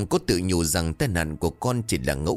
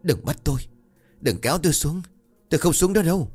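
screaming in terror; 8 semitones higher, faster than usual. A narrator's reading. Delirious Cường screaming at the ghost, terrified rising scream. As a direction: beg not to be dragged down, panic building. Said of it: "Cường gào lớn".

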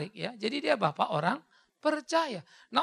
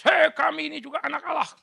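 indignant Pharisees; 6 semitones higher, 8 dB louder than usual. Retold or imitated. Pharisees protest to Jesus.

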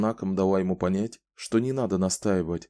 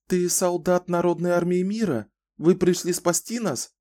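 high, hopeful woman's voice; hopeful frightened woman; 9 semitones higher than usual. A narrator's reading. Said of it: "спросила женщина".

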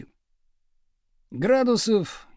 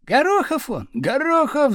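high, excited yells from the back; yelling; 8 semitones higher, 3 dB louder than usual. A narrator's reading. Pupils yelling from the back desks to the teacher, clamouring. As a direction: holler the surname twice, pitch raised. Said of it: "заорали с задних парт".

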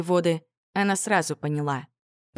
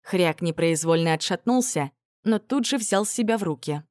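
ordinary delivery, nothing marked

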